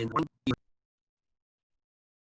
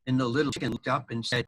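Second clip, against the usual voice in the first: plain reading voice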